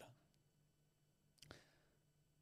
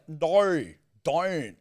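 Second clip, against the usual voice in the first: louder, higher broad Aussie no, don't; mock Aussie no, don't; 7 semitones higher, 6 dB louder than usual. Friend showing how Australians say no, don't, louder and higher. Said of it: "we go like".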